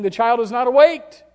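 put-on high, loud cry that the child won't wake; servant's call. Servant calling to the prophet, loud and high. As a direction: cry out the bad news from afar. Preacher voicing servant's report.

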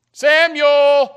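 high, loud calling out; a ringing summons. Acted. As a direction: call the name out loud and insistent. God calling Samuel at night.

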